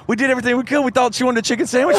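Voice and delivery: high, fast mock apology; a hint of a fretful lady; imitating the lady. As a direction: squeak out a flustered excuse. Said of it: "the lady came in the green room and said".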